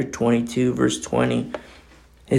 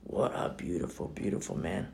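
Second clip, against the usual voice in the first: breathless hushed whisper, much softer; hushed reverent awe; awed whisper; 10 semitones lower, 19 dB quieter than usual. Real, not acted. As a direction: whisper it in wonder. Awed whisper about Stephen.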